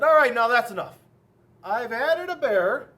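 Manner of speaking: loud, exasperated protest; fed-up author. A narrator's reading. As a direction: put your foot down, loudly. Author fed up with children's demands.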